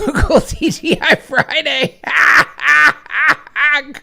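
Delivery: reading while cracking up, high and loud; laughing. Real, not acted. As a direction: laugh through the whole line. Laughs reading a super chat.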